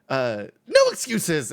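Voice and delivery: high, loud and slow; the hall monitor refusing the excuse, high, loud and slow. Acted. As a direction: cut him off, slow and prissy. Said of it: "hall monitor voice".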